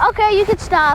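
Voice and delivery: high, loud, fed up; loud and annoyed. Real, not acted. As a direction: tell them firmly to stop. Telling someone to stop laughing.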